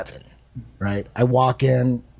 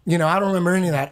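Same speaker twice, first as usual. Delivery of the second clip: ordinary talk, nothing marked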